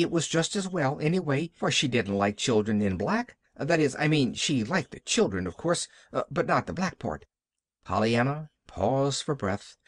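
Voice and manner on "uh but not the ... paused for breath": plain reading voice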